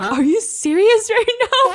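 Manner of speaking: slow, high, loud mock disbelief; acting out a flabbergasted reaction; incredulous. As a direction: stretch it out in stunned disbelief. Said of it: "he's like".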